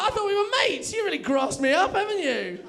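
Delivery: high-pitched mock-hurt pet-talk; special dog voice, betrayed. Man feeling betrayed by his new dog friend, squeaky reproach. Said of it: "still in the voice".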